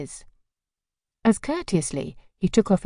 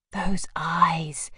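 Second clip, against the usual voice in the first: breathless whisper. Acted. Girl swooning over stranger.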